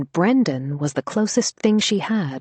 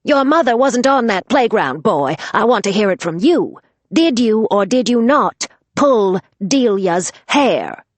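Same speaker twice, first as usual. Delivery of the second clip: raised voice; 5 semitones higher, 6 dB louder than usual. Acted. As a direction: raise your voice and demand the truth. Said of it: "Missus Haggerty raised her voice".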